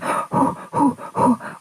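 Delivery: breathy panicked gasp; scared gasp. Retold or imitated. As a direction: gasp in fright. Scared at dentist.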